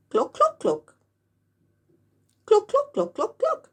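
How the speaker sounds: high, rising chicken clucks; hen clucking. Acted. The giant hen clucking in the yard, high. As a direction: cluck like a big brown hen.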